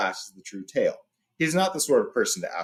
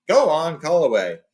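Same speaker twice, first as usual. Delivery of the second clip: louder, booming go-ahead; hearty permission; 5 semitones higher, 7 dB louder than usual. A narrator's reading. Beorn telling Gandalf to call his friends, booming. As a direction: wave it on with gusto.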